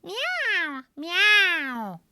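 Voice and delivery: high, twangy cat meows; high-pitched cat cries. Shown as a demonstration. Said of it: "you know, cats meow".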